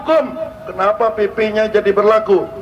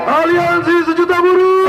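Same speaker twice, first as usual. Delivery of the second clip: fiery revolutionary speech; 5 semitones higher than usual. Real, not acted. Rally leader leads a chant.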